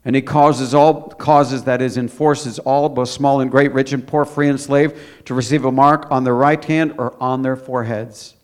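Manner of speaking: scripture reading. Acted. Reads Bible verse aloud.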